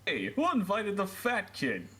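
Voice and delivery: mean Russian teenager, played for laughs; Kenny's Russian voice. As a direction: jeer in a hammed-up Russian accent. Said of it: "mean comment from the Russian".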